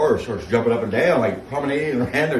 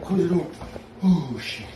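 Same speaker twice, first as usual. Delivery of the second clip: normal talking voice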